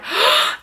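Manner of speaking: high startled gasp; mock gasp. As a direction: gasp in fake alarm. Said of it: "I'm like".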